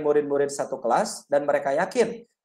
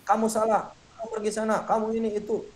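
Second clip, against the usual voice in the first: a stern teacher snapping at a pupil, pitched up; scolding punisher teacher; 5 semitones higher, 3 dB quieter than usual. Shown as a demonstration. Teacher scolding a student as the punisher, mock stern. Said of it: "Sebagai penghukum".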